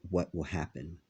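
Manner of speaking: ordinary delivery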